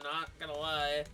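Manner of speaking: usual speaking voice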